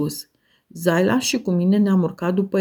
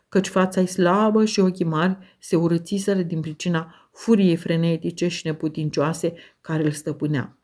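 plain reading voice

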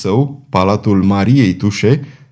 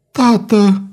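"tată" cried out much higher; heartrending cry; 10 semitones higher than usual. A narrator's reading. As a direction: wail "tată" at the top of her voice. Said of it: "strigă Violeta cu glas sfâșietor".

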